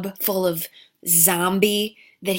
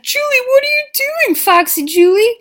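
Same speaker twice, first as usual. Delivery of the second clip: high, loud, fast alarmed cry; shocked shout; 10 dB louder than usual. Acted. Boyfriend finding Julie eating the owner, high alarmed shout. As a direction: shriek at her in disbelief. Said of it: "comes back".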